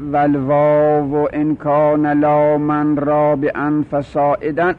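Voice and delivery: slow, drawn-out recital of a classical verse; verse recitation. Acted. Teacher reciting the rule's verse to the class, slow and chanted. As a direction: intone the line of grammar verse slowly.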